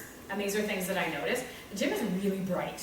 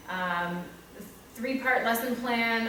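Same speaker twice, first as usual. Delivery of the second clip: ordinary talk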